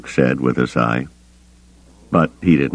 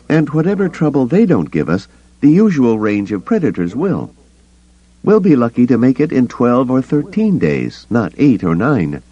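higher, grim wary woman; Mara character voice; 10 semitones higher, 3 dB louder than usual. A narrator's reading. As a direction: warn them gravely, voiced as Mara. Mara continues, pitched higher.

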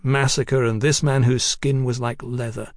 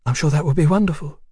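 quick, higher-pitched polite reply; placating woman; 4 semitones higher, 3 dB louder, faster than usual. A narrator's reading. Woman humouring the Texian's talk of marriage, placating.

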